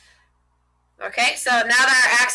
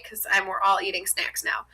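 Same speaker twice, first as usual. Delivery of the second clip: soft, lower, near-whispered aside; hushed aside; 3 semitones lower, 7 dB quieter than usual. Real, not acted. Teacher joking to students about snacks, hushed aside. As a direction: drop it under the breath as an aside.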